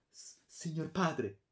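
high, slow, faltering plea; trembling thread of voice. A narrator's reading. Daughter facing her father in terror, trembling thread of voice. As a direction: stammer it out slowly, high and frightened. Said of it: "disse con un filo di voce tremante".

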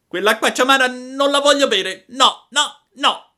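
very high, loud wailing refusal; tearful tantrum. A narrator's reading. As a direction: wail it like a child in a tantrum. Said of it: "dando in uno scoppio di pianto". (capriccio piagnucoloso, urlato e acutissimo)

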